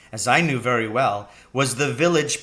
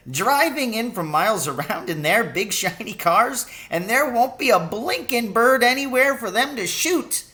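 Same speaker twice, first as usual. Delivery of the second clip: higher, mocking old cabbie voice; gleeful old Charlie; 10 semitones higher than usual. Old cabbie mocking the rich shooters, higher gleeful voice. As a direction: sneer at the fancy folk like a cheeky old poacher.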